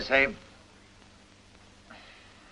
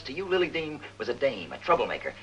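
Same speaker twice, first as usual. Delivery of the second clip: normal talking voice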